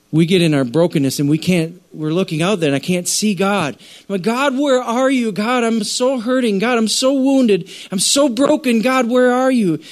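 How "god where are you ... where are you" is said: high, pleading cry to God; a hurting voice calling out to God; anguished prayer. Acted. Acted desperate prayer to God.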